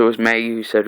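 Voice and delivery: usual speaking voice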